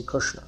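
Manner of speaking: Krishna with u in the first syllable; Bihari-style Krushna. Shown as a demonstration. Teacher giving listener's regional form, Krishna as Krushna. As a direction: say kru for kri in Krishna. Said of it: "they say Krishna".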